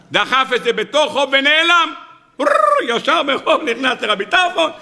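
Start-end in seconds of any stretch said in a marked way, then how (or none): 2.4-3.0 high rolled engine rev, imitating a motorbike racing away; motorbike revving. Speaker telling the hit-and-run story imitates the bike's engine roar. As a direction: roll the r like a bike engine.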